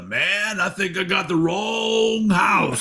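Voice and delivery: high, slow drawn-out shouted chant; crowd singalong yell. Retold or imitated. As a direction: belt it like a concert crowd line. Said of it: "have the whole crowd yell".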